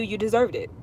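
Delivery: ordinary talk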